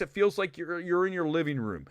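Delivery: ordinary talk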